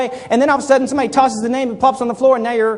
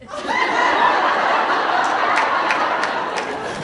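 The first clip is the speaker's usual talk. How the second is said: high unvoiced laugh; 4 dB quieter than usual. Laughs while acting the scene.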